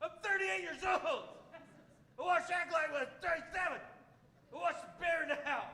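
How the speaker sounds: put-on high, indignant kid voice from a grown man; a thirty-eight-year-old huffing like a child; offended adult as kid. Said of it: "having that same conversation with an adult friend".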